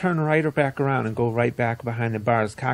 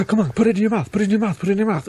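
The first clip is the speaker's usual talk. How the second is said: mock pushy boyfriend; 8 semitones higher, 4 dB louder, much faster than usual. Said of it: "It's like".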